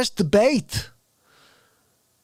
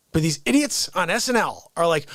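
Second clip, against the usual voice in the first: ordinary delivery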